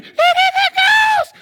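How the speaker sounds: high, loud shriek of terror; panicked high scream; terrified cry. Acted. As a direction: shriek it in panic, half laughing. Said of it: "They were afraid".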